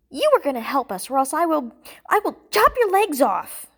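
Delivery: angry threat rising to a loud high yell; furious, escalating outburst; spat threat. A narrator's reading. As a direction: spit it out, building to a shout. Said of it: "Jessie spat".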